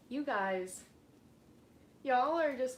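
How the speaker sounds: ordinary talk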